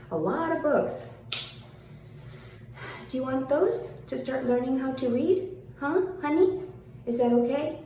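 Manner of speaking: put-on high, sing-song baby talk; condescending taunt. Mocking the critic who called her the problem, condescending. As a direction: talk down like to a toddler.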